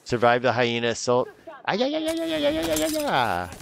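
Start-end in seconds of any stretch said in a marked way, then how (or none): 1.7-3.5 very high squeaky yeah, yeah; falsetto yeahs. Pretend. Reacting to the hyena assault.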